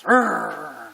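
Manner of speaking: loud rolled growl; imitated dog's growl. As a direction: growl like a warning dog. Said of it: "the dog growls".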